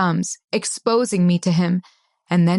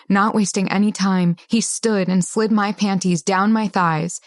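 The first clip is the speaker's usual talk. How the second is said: usual speaking voice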